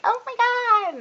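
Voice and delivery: high mock squeal of excited fans; squealed, not shouted; fangirl squeal. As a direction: imitate fans swooning at high pitch. Imitating the screaming fans.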